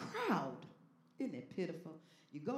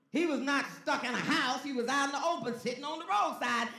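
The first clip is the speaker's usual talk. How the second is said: raised, higher preacher voice; full-force preaching; 6 semitones higher, 8 dB louder than usual. Real, not acted. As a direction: drive it home loudly to the congregation.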